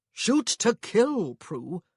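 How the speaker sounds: slow, loud stern order. A narrator's reading. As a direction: lay down the law slowly. Angry husband's advice.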